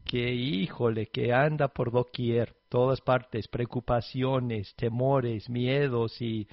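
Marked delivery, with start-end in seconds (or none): none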